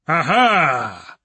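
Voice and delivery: put-on drunkenly slurred exclamation. A narrator's reading. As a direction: slur it out, woozy. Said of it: "he slurred".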